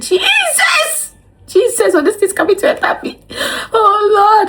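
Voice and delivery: high, frightened shrieks to Jesus; high-pitched alarmed wailing; panicked cry. Retold or imitated. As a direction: cry out in panic as it comes at you. Panicking at the speculum.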